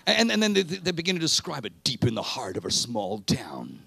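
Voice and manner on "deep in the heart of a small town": big movie trailer announcer. Pretend.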